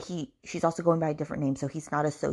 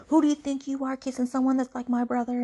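high, indignant retold outburst; mock pissed off; 8 semitones higher, 3 dB louder than usual. Man angry at her kissing his brother, acted indignant. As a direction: imitate his jealous telling-off, pitched up. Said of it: "pissed off. He gets all pissed off".

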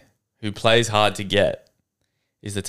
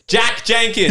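high, raised-voice name-calling; playful call-out; 12 semitones higher, 4 dB louder, slower than usual. Real, not acted. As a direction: announce the culprit's name loudly. Calls out a named person.

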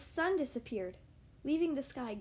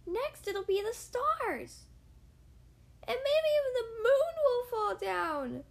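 high, loud panicked shriek; 9 semitones higher, 5 dB louder than usual. Acted. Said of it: "she shrieked".

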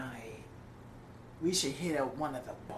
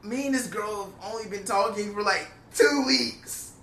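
high, loud disbelief; telling his friend off, high exasperated rant; 7 semitones higher, 4 dB louder than usual. Retold or imitated. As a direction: climb higher with each outraged phrase. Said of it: "I was like".

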